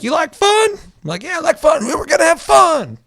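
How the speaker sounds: very high, loud, bubbly cheer; cheery mock excitement. Nintendo persona cheering on the player, high, loud mock cheer. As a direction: cheer like an overexcited kids' host.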